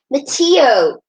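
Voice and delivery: loud angry call of a name; angry shout. Acted. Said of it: "Christy's voice sounded very angry".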